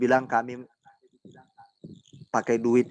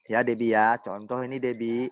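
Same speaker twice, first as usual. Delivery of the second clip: ordinary talk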